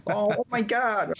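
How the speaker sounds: slow, high mock London accent; attempted London accent. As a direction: draw out a badly faked London squeal. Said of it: "I can't do a London accent".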